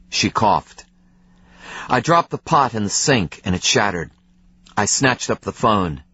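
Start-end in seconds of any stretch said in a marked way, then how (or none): none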